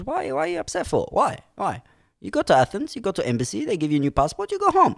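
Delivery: high-pitched, dismissive foreign-official voice; imitated Greek sergeant. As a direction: brush off the worry in broken English.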